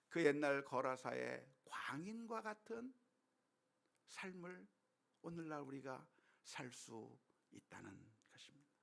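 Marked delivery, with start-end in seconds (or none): none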